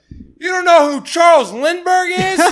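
high-pitched mock outrage; furious, retold. Heckler furious nobody knows his reference, high mock outrage. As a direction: shriek it as an imitated, incredulous heckler. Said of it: "he was furious".

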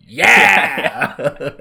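loud, high, drawn-out whoop; hammed-up excited yell breaking into laughter; mock fan cheer. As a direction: cheer as if the hero just scored. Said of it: "I'm like".

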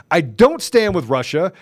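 ordinary talk, nothing marked

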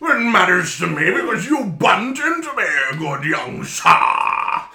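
put-on grandiose old-fashioned gentleman; pompous gentleman. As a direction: scold the lad with airs. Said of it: "Why does Arson not talk like this".

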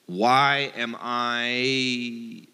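"Why am I?" drawn out very slowly, each word weighted; slow emphatic. Shown as a demonstration. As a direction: linger on "Why am I?". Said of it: "So it's just".

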